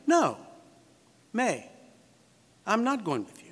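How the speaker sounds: firm, separated refusal; measured acted refusal; negative answer. Shown as a demonstration. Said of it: "you expect a no answer".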